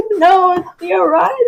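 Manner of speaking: giggling through the words; laughing correction. Real, not acted. Correcting the host's joke name, laughing. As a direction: correct him while laughing.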